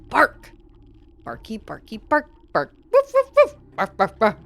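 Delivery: a cat clumsily playing dog; hammed-up barks and woofs; cat barking like a dog. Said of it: "Tiger has to learn how to be a dog".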